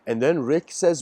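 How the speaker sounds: usual speaking voice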